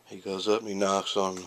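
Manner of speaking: ordinary talk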